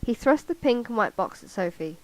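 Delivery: animated, projected book reading; story narration. A narrator's reading. Vlogger reading a novel passage for viewers, animated storytelling. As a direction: read the story aloud with lively character dialogue.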